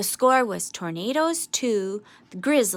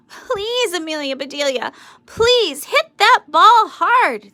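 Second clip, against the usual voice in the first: high, loud, slow begging; worried pleading; 8 semitones higher, 9 dB louder, slower than usual. A narrator's reading. Worried Grizzlies begging Amelia Bedelia at bat, loud and high. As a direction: beg her to hit it. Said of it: "The Grizzlies were worried".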